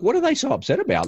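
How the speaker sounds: put-on a daughter wonders why the other kids are upset, in a high, baffled voice; puzzled daughter. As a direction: ask it high and bewildered like a young girl. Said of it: "she's like".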